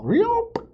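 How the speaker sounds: sudden high squeal of a trapped fox; fox yelp. Acted. Fox caught in the loop, high squeal. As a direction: shriek it out like a snared fox.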